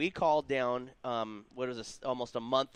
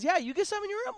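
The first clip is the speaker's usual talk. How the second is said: much higher, squeaky giddy question; mock excited question; faster than usual. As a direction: play it like a giddy kid on the phone. Friend echoes the question.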